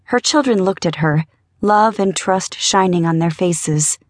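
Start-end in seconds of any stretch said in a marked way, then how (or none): none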